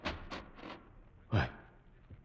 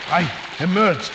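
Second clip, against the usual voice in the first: gasping; 11 semitones higher, 7 dB louder than usual. Acted. Surfacing from water.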